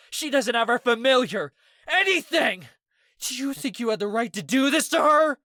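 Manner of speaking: high, loud furious yelling; explosive rage. A narrator's reading. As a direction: scream it at the friend who hurt your sister. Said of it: "Ez exploded".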